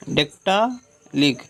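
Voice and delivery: "डेक्टालिक" slowly, long "dektaa", pause, short "lik"; syllable-by-syllable. Shown as a demonstration. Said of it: "तो strong फिर वीक".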